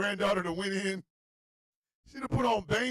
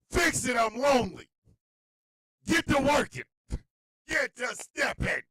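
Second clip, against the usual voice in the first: high, loud orders; mock-menacing little boss; Denzel-Bane toddler; 7 semitones higher, 3 dB louder than usual. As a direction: order mom around, punching each phrase. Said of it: "in my Disbane voice".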